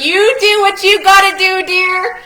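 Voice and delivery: high, loud sing-song quote; retold reassurance. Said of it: "and they said".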